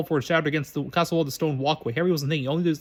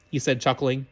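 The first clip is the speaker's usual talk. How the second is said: ordinary delivery, nothing marked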